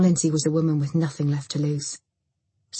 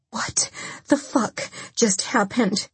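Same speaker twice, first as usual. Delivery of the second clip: high, slow, halting shock; stunned, word by word; shaken; 5 semitones higher, slower than usual. A narrator's reading.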